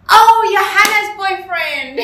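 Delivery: high, drawn-out loud realisation; retold shout. Re-enacting the blurt to the caller, high shouted realisation. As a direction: blurt it out loudly, stretched and high. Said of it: "I shouted it out so loud".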